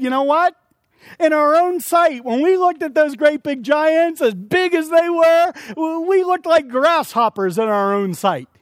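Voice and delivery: high, loud, wide-eyed telling; excited. Acted. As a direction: tell it like frightened spies. Spies report on the giants.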